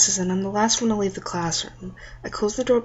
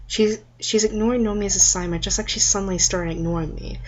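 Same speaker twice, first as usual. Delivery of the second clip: usual speaking voice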